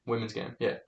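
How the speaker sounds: muttered answer to his own question; quiet confirmation. Real, not acted. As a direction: say it under the breath, settling it.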